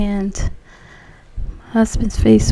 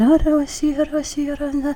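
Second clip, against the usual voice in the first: imitating tongues, higher rapid syllables; speaking in tongues; 6 semitones higher, faster than usual. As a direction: babble the prayer language in a lilting stream. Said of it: "I just started talking in tongues".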